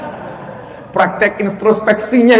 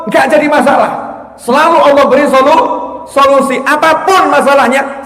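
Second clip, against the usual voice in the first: raised, forceful preacher's voice; impassioned preaching; 6 semitones higher, 6 dB louder than usual. Acted. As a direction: preach it out loud and high.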